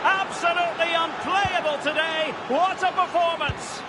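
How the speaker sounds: high, ringing commentator shout as the ball goes in; excited goal call. Acted. Commentator calling a goal to viewers, excited high shout. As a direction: bellow the goal to the crowd.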